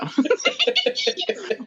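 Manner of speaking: a short high burst of laughter; laughing at the troll. Real, not acted.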